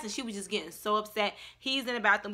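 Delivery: usual speaking voice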